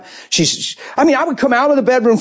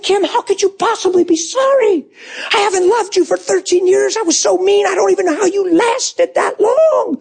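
high, strained tearful plea; re-enacted tearful confession to a wife; bawling self-reproach; 11 semitones higher than usual. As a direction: protest through tears, pitched way up. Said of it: "I'm bawling".

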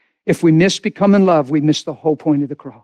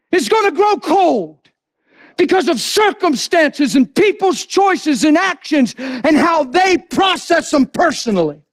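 high, fervent sermon cry; impassioned preacher pitched way up; full-force preaching; 12 semitones higher, slower than usual. Real, not acted. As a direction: hammer the scripture home, stretching key phrases.

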